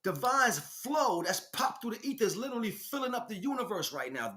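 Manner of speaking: ordinary talk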